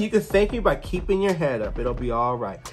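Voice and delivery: low, soft comfort; Daisy calmly reassuring her friend. Retold or imitated. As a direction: gently tell her it'll be fine. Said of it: "Daisy's like".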